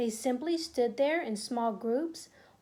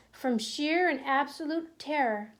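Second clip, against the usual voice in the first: ordinary delivery, nothing marked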